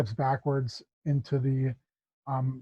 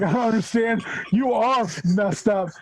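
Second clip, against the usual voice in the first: higher, laughing confusion; laughing mock confusion; 7 semitones higher than usual. Thief laughing at the hobgoblin, higher and amused. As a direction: fight the giggle while baffled.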